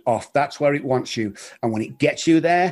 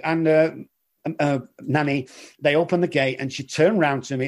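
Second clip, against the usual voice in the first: ordinary talk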